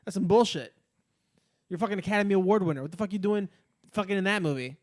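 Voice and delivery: loud, exasperated rant at a sellout; heated outburst of disbelief; angry rant. Real, not acted. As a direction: chew him out for taking the role. Ranting at an actor's movie role.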